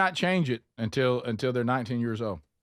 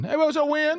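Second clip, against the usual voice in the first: cheery greeting; 12 semitones higher than usual. Pretend. Greeting the imagined kid.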